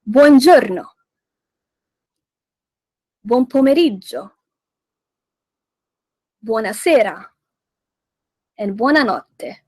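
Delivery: buongiorno, buon pomeriggio, buonasera, buonanotte said with emphasis; exaggerated Italian greetings. Shown as a demonstration. Host leading listeners in repeating, emphatic Italian greetings. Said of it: "let's repeat".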